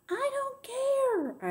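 very high, stretched-out holler; defiant drawn-out cry. A narrator's reading. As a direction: wail it out slowly and high. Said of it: "I hollered".

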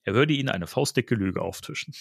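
ordinary delivery

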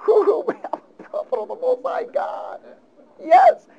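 very high, squealing triumphant cry; mock exultant Elijah. Preacher mimicking Elijah's triumph, squealing high. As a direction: crow in giddy triumph.

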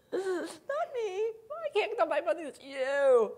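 high, crying-edged protest; panicked denial. Retold or imitated. Howard denying blame to the boss, panicked. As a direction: plead your innocence near tears. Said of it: "And Howard says".

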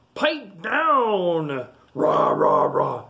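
loud roaring alligator. A narrator's reading. Alligator tells the sleeper off, loud roaring voice. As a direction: start high, then roar downward. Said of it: "says the alligator".

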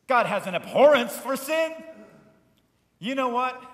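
loud, high declaration; loud preaching. Acted. Pastor proclaiming God's abhorrence of sin, loud preaching. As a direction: proclaim it to the whole room.

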